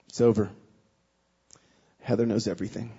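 low, hushed, final; grave bad news. Best friend telling him his marriage is over, grave and low. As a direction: deliver it low with heavy pauses.

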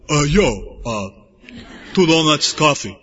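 put-on laid-back, hesitant Philadelphia accent order. Roommate ordering in a London coffee shop, Philadelphia accent. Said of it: "my friend's from Philadelphia. He's a dead giveaway".

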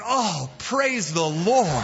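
slow, drawn-out heartfelt exclamation; grateful relief. Acted. Rider safe on the precipice, slow grateful praise. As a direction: let the relief stretch every word. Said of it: "he's so grateful".

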